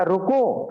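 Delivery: "रुको" set apart as a whole command; full stop after रुको. Shown as a demonstration. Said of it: "full stop दे दिया". ("रुको" पर पूर्ण विराम)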